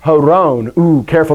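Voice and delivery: normal talking voice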